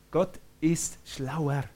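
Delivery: slow, deliberately spaced words; slow emphasis. Real, not acted. Preacher drives the point home to the congregation, slow and emphatic. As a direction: let each word land slowly.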